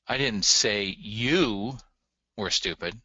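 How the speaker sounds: YOU stretched out and punched, then a pause; stress on you. Shown as a demonstration. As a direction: lean long and hard on "you", drop the rest. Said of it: "How would you read this sentence".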